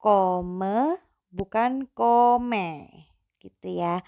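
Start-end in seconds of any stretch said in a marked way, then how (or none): 0.0-2.9 kome then kome, drawn out and slow; slow contrast. Shown as a demonstration. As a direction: set the two vowels apart slowly. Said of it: "jangan lupa ya".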